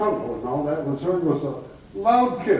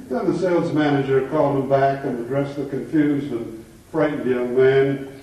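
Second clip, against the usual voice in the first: usual speaking voice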